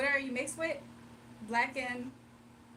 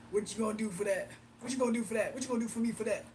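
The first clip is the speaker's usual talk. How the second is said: creepy man voice; 4 semitones lower, faster than usual. Retold or imitated. Mocking a creepy man who wants payment for help. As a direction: lower mock creep voice.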